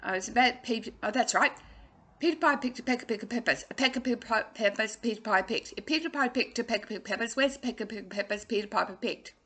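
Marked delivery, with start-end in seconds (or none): 2.2-9.3 rattled off very fast; fast tongue twister. Shown as a demonstration. Coach showing how fast she can say it. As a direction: race through the twister. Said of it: "I can say it really fast".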